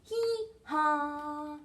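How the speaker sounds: sung-out, two-note hee haw; donkey bray imitation. Shown as a demonstration. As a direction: bray like a donkey, holding each half. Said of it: "A donkey says".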